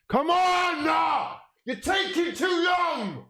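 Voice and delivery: very high, loud, slow yell of frustration; frustrated scream. Real, not acted. Fan screaming at an Arsenal player for taking too long, frustrated yell. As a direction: scream at the screen in despair.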